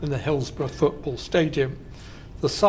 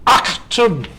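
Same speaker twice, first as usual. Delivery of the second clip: badly faked German accent, barked out; mock German accent; 3 semitones higher, 7 dB louder than usual. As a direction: shout it like a hammed-up German officer. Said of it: "I can't do a Nazi accent".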